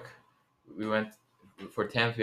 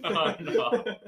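laughing; 10 semitones higher, 3 dB quieter than usual. Real, not acted. Laughing while saying a name.

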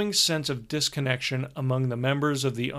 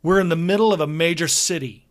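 spat; 4 semitones higher, 7 dB louder, faster than usual. A narrator's reading. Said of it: "Brian spat, losing his cool".

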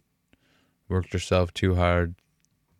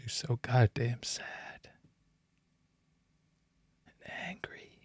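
whispered confession, barely voiced; hushed, raw whisper of real sadness; 4 semitones higher, 11 dB quieter, slower than usual. Real, not acted. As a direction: let the hurt sink to a whisper. Confessing childhood hurt, breaking off.

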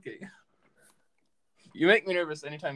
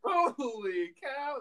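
amazed; 7 semitones higher, much slower than usual. Real, not acted. Amazed at friend's flexed muscle.

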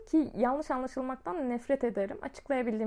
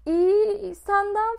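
higher, louder startled answer; flustered reply; 7 semitones higher, 6 dB louder, much slower than usual. Retold or imitated. Caught off guard by his tone, she blurts a high startled reply. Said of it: "filan oldum ben böyle".

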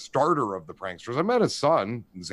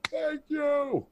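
grateful friends. Retold or imitated. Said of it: "they'd be like".

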